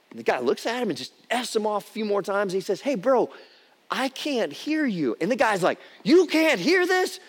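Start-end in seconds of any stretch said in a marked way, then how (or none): 2.8-5.2 calm teasing reply. Retold or imitated. Jack repeats, pitched up, slowed. 6.0-7.2 high, loud and slow, a hammed-up road rager's yell; mock angry shout.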